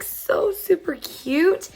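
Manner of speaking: high, slow coo of delight; gushing. Real, not acted. Showing viewers a makeup bag, cooing with delight. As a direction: coo over something adorable.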